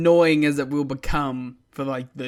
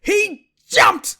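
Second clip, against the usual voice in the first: high, loud hype shout; enthusiastic exclaim; 10 dB louder than usual. Retold or imitated. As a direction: cheer it like the biggest fan. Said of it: "the world's most enthusiastic "he jumped".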